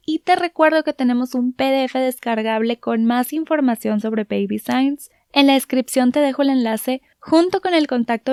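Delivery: podcast host reading the closing plug; podcast outro. Acted. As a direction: wrap up the episode like a bright promo read. Host outro read over music.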